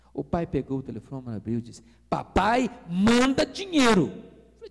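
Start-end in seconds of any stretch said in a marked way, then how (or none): 2.1-4.1 louder, slow indignant read of the telegram; furious father reading the message aloud; angry reading. Retold or imitated. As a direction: spit out the son's request in outrage. Father reads telegram angrily.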